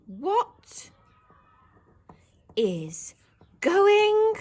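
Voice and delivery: loud, word by word, slow at the end; dictated shout. Shown as a demonstration. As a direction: shout it out while writing. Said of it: "he's shouting it".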